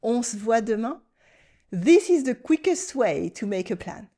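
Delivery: ordinary talk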